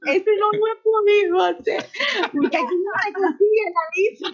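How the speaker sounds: very high voice squeezed by real laughter; helpless, high-pitched laughing reply; laughing admission. Real, not acted. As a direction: confess it while cracking up. Laughing admission after being teased.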